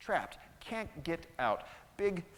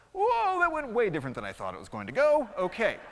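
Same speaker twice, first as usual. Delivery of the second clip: startled mouse; 6 semitones higher, 9 dB louder than usual. Acted. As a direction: yelp in alarm, then backpedal. Mouse startled by lion's threat.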